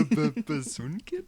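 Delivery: slow, stuttering tease; exaggerated halting echo; mocking stammer. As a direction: parrot a friend's stumble over the words. Mocks the friend's stumbling title.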